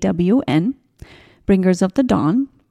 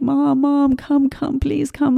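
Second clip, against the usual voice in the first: high, mock yell for mom without volume; son's retold yell; 7 semitones higher, slower than usual. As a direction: imitate a child calling out, pitched high. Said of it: "I hear my son yell".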